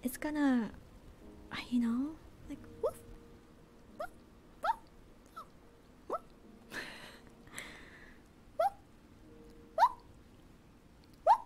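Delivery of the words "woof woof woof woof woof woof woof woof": quiet little woof sounds, barked softly; gentle whispered dog barks; soft barking. Pretend. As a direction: bark like a small puppy, but hushed. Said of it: "when I do the barking sounds".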